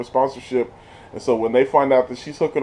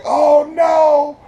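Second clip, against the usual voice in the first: mock horrified; 12 semitones higher, 5 dB louder than usual. His own reaction voiced.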